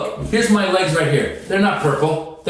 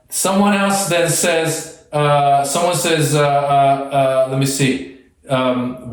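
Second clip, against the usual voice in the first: ordinary talk, nothing marked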